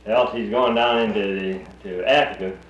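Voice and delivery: ordinary talk, nothing marked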